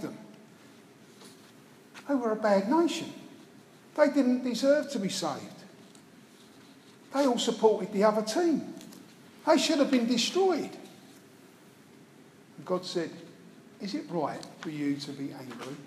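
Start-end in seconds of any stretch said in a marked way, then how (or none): 2.1-10.7 higher, rising whiny indignation, hammed-up; sulky prophet ranting that they deserved it; mock indignant Jonah. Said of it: "is still angry". 13.8-15.8 much softer, lower, calm questioning; gentle question. Retold or imitated. God gently questions angry Jonah.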